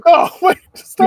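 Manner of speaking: high, loud mock panic; alarmed Goku. Goku panics as Broly nears snapping, mock alarm.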